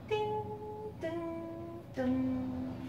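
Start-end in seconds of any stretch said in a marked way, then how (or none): none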